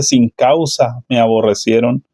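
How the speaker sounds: ordinary talk, nothing marked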